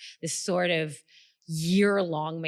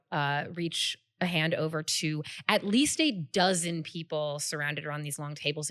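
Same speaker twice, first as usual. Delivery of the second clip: ordinary delivery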